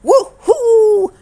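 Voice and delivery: high, loud woohoo of delight; cheer. Acted. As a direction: cheer like a thrilled kid. Cousins buy lemonade, cheering.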